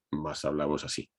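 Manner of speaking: flat Madrid voice. Shown as a demonstration. Speaker demonstrating central Spanish speech to the audience, low flat monotone. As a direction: say it low and level with no melody. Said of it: "Cuanto más al centro vas en España".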